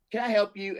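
lightly voiced bored clerk; postal clerk voice.

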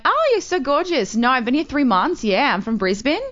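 put-on gushing Aussie girl, swooping high at the start; fake Australian accent. Shown as a demonstration. Fooling clubbers that she's from Brisbane, Australian accent. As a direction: pass yourself off as a chatty Australian. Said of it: "I'll go in a club and I'll be like".

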